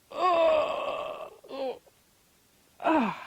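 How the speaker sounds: long straining grunts; loud effortful heave; groan of effort. A narrator's reading. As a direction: groan as if hauling up a heavy body. Rooster straining to jump up.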